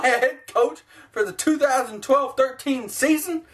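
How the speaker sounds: ordinary delivery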